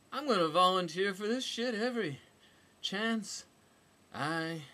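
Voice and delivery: brash heroine voice; character voice. A narrator's reading. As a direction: spat out high and loud. Character's defiant quoted line.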